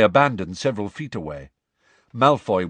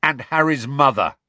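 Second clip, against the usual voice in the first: louder, resentful snarl; 5 semitones higher, 5 dB louder than usual. A narrator's reading. Pupil piling on reasons for fighting, snarling. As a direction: add the grievance with a snarl. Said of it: "snarled George".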